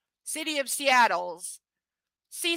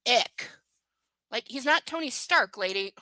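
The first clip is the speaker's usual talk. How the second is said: ordinary delivery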